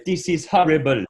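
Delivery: light West African accent; mild West African accent, This is horrible. Shown as a demonstration. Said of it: "West African".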